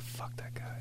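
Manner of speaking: hissed under the breath; whispering. Retold or imitated. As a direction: whisper it with spite. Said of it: "I'm like".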